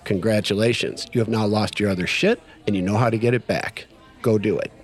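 low, measured mentor voice; solemn verdict. Retold or imitated. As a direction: deliver the sponsor's lesson calmly, with pauses. Sponsor's solemn closing verdict, retold.

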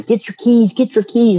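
imitated screaming. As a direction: imitate a frantic neighbor's warning. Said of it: "comes running through the door screaming".

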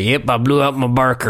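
lightly voiced old man; a hint of an old codger. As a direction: quote it as a mild old man. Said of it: "an old man would say".

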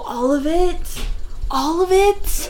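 slow, rising disbelief; acted dismay. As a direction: stretch each word in mock horror. Acted dismay at smashed roof.

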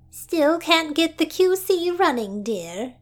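high, gentle sisterly concern; sympathetic sister. Acted. Sister consoling the doctor over the broken computer, sympathetic and high. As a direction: coo it sympathetically to her sister. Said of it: "she asked in a sympathetic voice".

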